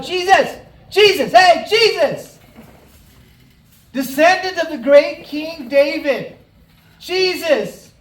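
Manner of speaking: high, full-voiced shouting to get attention; yelling out. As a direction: yell for Jesus at the top of your lungs. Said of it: "he just starts to yell out".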